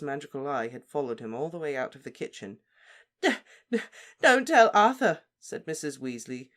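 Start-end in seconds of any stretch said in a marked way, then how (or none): 3.2-5.2 high, loud, stammering sobs; gulping. Acted. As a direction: gulp out the words. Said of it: "gulping now".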